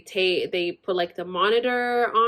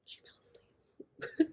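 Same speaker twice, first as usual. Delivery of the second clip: slow, secretive whispered aside; 15 dB quieter, slower than usual. Real, not acted. Telling the viewers mom dozed off, slow whisper.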